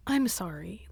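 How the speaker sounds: very soft retold sorry; small, hushed apology; meek apology. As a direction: apologise under your breath.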